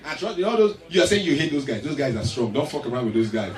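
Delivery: usual speaking voice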